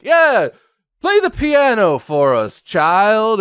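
showy parent. Retold or imitated. TV parent voiced.

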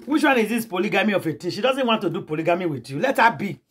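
usual speaking voice

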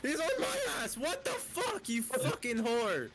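high, frantic yell; panicked furious yell. Real, not acted. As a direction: shout while fleeing the killer. Chased by killer.

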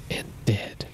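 a hushed, grieving whisper to close the poem. Acted. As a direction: breathe the last line out in a hush. Dramatic end of poem reading.

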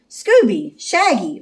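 summoning them from a distance; calling out. A narrator's reading. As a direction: call two friends by name. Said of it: "Velma called".

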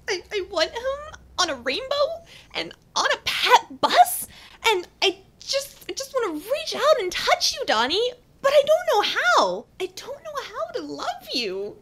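high, breathless gushing over her crush; swooning confession. Acted. Dating video confession to pop star, high lovesick gushing. Confessing burning desire for [name].

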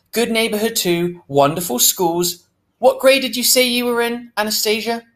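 high, sugary saleslady voice; fake voice. A narrator's reading. Real estate lady chats up the girl, high fake voice. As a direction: coo falsely like a pushy realtor. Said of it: "in a fake voice".

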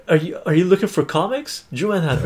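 ordinary talk, nothing marked

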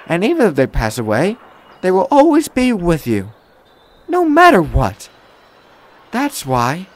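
high-pitched, earnest reassurance; kind young woman, comforting. A narrator's reading. Woman tells Thomas what Christmas is about, comforting.

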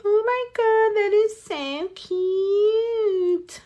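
high, slow, drawn-out gushing fan; gushing viewers. Retold or imitated. Imitating viewers reacting to her keychain nails, high gushing. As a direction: mimic fans swooning over cute nails. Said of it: "You guys are gonna be like".

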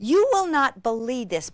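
normal talking voice

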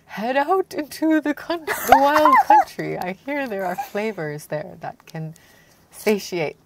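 grand British king's speech; British accent. Acted. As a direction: read it out in a put-on British accent. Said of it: "who's better at doing the British accent".

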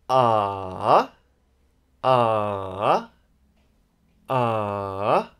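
AH dipping low, then rising; long, scooped AH. Shown as a demonstration. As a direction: let each AH sink low and climb back. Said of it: "we can call it a dipping tone".

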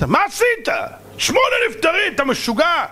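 high-pitched outraged boss; acted furious dressing-down; angry scolding yell. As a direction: yell at the doctor in disbelief. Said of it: "אתה עוד צועק עליי".

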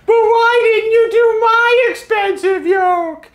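high, loud, drawn-out mock wail; whiny complainer. Reviewer mimics viewers' complaints about skipped yokes, whiny mock wail. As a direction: wail it like an exaggerated tearful protest. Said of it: "the response was the same".

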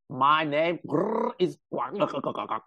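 slow, growling insect alien; Geonosian alien voice. Pretend. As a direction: drag it out with a clicking growl. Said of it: "I love your Geonosian voice".

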